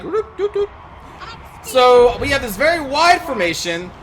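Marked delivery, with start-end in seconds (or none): 0.0-0.7 silly sound effect. Retold or imitated. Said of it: "they're gonna go like".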